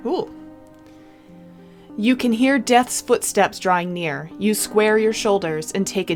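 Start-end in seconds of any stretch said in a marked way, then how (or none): none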